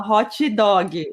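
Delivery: "hot dog" said slowly as "HO-chee DOH-ghee", Brazilian-style; Brazilian accent. Shown as a demonstration. Host demonstrating Brazilians' English, slow Brazilian-accented "HO-chee DOH-ghee" for "hot dog". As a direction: add a Brazilian "ee" after each word of "hot dog". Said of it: "acaba colocando o, o I ou Y".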